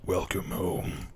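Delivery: slow, hushed eerie voice; eerie figure. Acted. Shadowy figure welcoming someone home, slow eerie hush. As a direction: draw it out softly.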